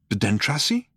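usual speaking voice

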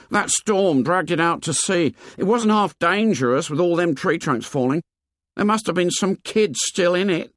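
put-on high-pitched, earnest boy's voice; high boyish character voice; fat schoolboy. A narrator's reading. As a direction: play an anxious plump schoolboy, pitched high.

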